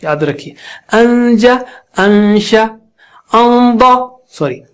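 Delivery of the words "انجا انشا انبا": level, sustained nasal hold before each letter; ghunna drill. Shown as a demonstration. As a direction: hum the n long in انجا، انشا، انبا. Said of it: "نون ساکنہ بھی بہت موٹا".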